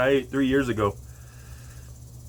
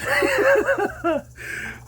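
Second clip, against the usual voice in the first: nervous laugh. Real, not acted. Nervous before eating pepper.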